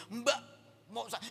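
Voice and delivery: high, half-held-back mock scolding; pretend telling-off. Passenger about to scold the stewardess, mock angry. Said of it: "saya ini mau marahin pramugarinya".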